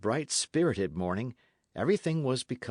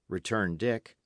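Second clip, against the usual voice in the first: plain reading voice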